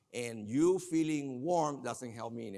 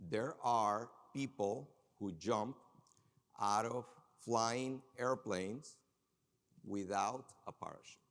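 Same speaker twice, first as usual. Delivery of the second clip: slow emphatic repeat; 2 semitones lower, slower than usual. Acted. Speaker to audience, slow emphatic repeat. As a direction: stretch the words slowly, leaning in.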